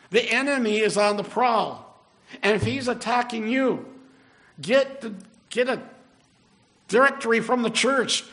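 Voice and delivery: rally the congregation, pitched high and forceful; urgent preaching. Real, not acted.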